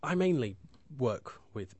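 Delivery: ordinary talk, nothing marked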